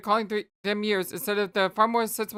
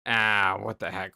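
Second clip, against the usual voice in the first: ordinary talk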